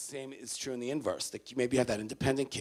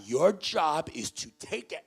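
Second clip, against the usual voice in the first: normal talking voice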